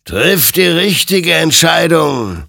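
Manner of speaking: slow, loud, high-pitched warning; drawn-out, imperious exhortation; admonishing. A narrator's reading. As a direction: admonish like a commanding apparition. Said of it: "ermahnte ihn sein Gegenüber".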